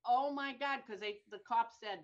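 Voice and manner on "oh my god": louder, higher mock exasperation; retold dismay. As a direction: re-enact a sinking "not this" reaction. Retold dismay at having to return.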